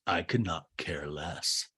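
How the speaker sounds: a flat, unbothered put-down; mock dismissive Craig. Craig brushing off Whitney's apology, low and bored.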